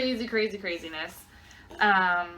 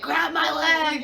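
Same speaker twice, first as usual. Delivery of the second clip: high, slow strained call for help; urgent plea; 7 semitones higher, 4 dB louder, slower than usual. Retold or imitated. Mother in labour begs partner to hold her leg, strained and high. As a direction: beg him to grab her leg mid-contraction.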